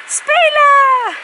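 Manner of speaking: high, loud playground whoops; loud gleeful squeals on the playground; playful shouting. Real, not acted.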